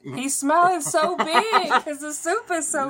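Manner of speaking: high, slow laughing tease. Real, not acted. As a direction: tease him through laughter. Teasing him while laughing.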